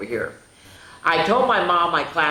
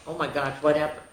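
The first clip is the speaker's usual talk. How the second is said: ordinary delivery, nothing marked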